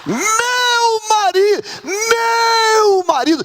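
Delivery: very high, sustained wail on "meu"; imitated adoring cry. Shown as a demonstration. Preacher leading the sisters in imitating her, adoring cry. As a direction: cry it out slowly, holding the first word. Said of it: "quem vai imitar essa moça".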